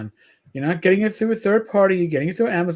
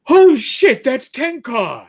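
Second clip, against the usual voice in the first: surprised exclamation; 5 semitones higher, 5 dB louder, slower than usual. Retold or imitated. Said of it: "all of a sudden I hear".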